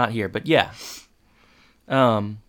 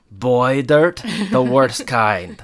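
stern teacher voice; 4 dB louder, much slower than usual. Retold or imitated. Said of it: "And she goes".

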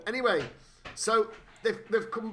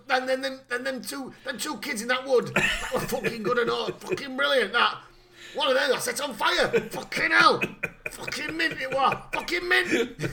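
much higher and louder, building to a whooping yell; old lunatic bragging about past killings, getting giddier; hammed-up Kincaid glee; 7 semitones higher, 5 dB louder than usual. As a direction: relish every killing like a treat. S0 voices Kincaid reminiscing.

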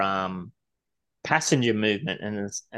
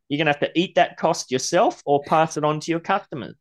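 ordinary talk, nothing marked

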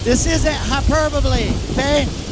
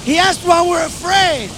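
mock exasperated rant; 6 semitones higher than usual. Disciples' exasperated rant, voiced.

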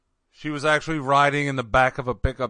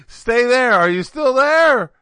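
calling loudly down a bad line; shouting into a phone; 10 semitones higher, 8 dB louder than usual. Real, not acted. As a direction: raise your voice so the caller hears.

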